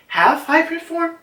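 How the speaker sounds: "half hybrid form?" with a big high questioning rise; unsure question. Shown as a demonstration. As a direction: ask "half hybrid form?" as if checking yourself. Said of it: "Yeah, yeah, in her half hybrid form".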